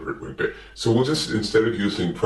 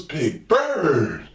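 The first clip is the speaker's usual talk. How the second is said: much higher, slow, exasperated; high put-on Gordon voice; much slower than usual. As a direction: spell it out to Big Bird, high and slow. Gordon's punchline to Big Bird.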